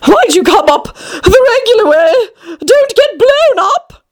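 high-pitched, flustered queen voice. A narrator's reading. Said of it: "panted the queen".

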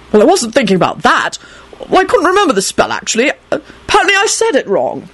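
high, flustered self-defence, climbing; flustered excuse. Acted. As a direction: babble the excuse nervously. Arthur nervously defends his botched spell.

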